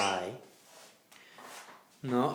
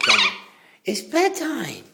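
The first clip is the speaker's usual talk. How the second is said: high cooing; 12 semitones higher than usual. Real, not acted. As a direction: coo bedtime to the pet. Cooing bedtime to bird.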